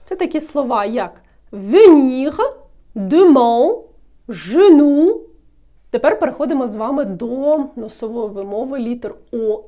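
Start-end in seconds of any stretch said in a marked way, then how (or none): 1.5-5.2 venir, demain, genou loud and drawn out as examples; no nasal sound. Shown as a demonstration. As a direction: articulate venir, demain, genou loudly, N and M as plain consonants. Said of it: "тоді носової вимови не буде".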